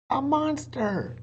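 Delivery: high, slow alarmed cry; excited cry. Acted. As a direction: point and cry out at the monster. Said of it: "Annie cried".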